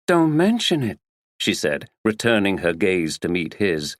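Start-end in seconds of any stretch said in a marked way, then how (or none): 0.1-1.0 light American brush-off; American accent. A narrator's reading. Actress Tina to the detective, breezy American accent. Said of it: "She spoke in an American accent".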